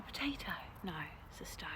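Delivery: hushed whisper over a find; whispered excitement, then a letdown. Real, not acted. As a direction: breathe it out in a whisper, no voice. Whispering over a possible potato.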